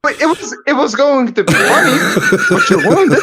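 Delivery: high, loud laughing whine. Real, not acted. Friend complains laughing that his joke was ruined.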